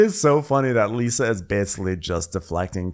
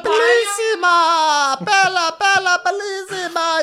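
loud, high, slow sing-song Bellissima; booming sing song welcome; 5 dB louder, slower than usual. Retold or imitated. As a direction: sing it out like a hammy waiter. Joins waiter impression.

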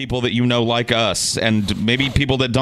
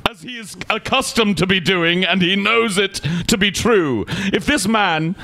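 put-on pompous old squire; 6 semitones higher than usual. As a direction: puff up like an offended English gentleman. Plays a posh squire character.

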